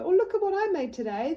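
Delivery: put-on high, loud, eager child showing off; bursting with pride, voice up high and loud; excited child. Child showing mother what they made, high excited voice. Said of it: "and they go".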